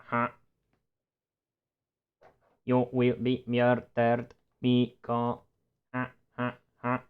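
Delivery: stiff robotic ha ha; robot voice laugh and threat. Acted. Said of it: "It needs to sound more robotic".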